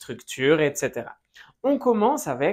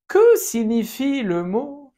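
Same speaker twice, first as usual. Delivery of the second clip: slow, higher, louder model question; slow model phrase; 6 semitones higher, 4 dB louder than usual. Shown as a demonstration. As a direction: say it slowly so students can copy. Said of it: "¿Qué significa la palabra?". (pregunta modelo lenta y aguda)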